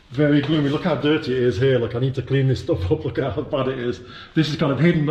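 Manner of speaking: ordinary talk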